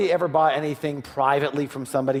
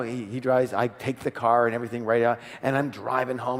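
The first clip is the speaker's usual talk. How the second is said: normal talking voice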